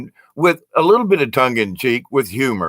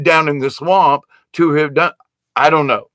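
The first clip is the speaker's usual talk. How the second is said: ordinary talk, nothing marked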